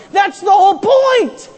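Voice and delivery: high, loud indignant yell on "That's the whole point"; angry crowd shouting down the prophet; outraged shout. Acted. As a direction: fling "That's the whole point" back in fury. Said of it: "They would have screamed".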